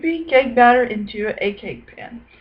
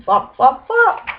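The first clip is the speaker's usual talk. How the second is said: ordinary delivery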